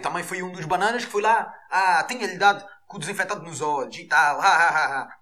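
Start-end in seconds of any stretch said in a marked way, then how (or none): 4.4-5.2 laughing. Real, not acted. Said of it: "laughing at own joke".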